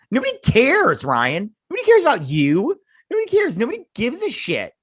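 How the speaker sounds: high, loud mocking disbelief at the show; incredulous rant. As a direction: rant at the screen in exasperation. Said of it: "I'm all like".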